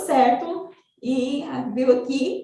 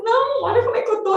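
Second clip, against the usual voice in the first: high-pitched re-enacted outburst; retold yelling; 10 semitones higher, 4 dB louder, faster than usual. Exhausted mother explodes at son, retold high-pitched yelling. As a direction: cut in exasperated, pitch shooting up. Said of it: "eu gritava".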